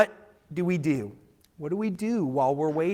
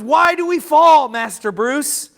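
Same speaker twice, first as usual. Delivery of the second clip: put-on own voice, no accent; 7 semitones higher, 10 dB louder than usual. Preacher retells the Batman Begins line in his own voice, loud. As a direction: quote dropping the butler accent, louder and higher. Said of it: "I'm gonna stop trying to do the weird accent".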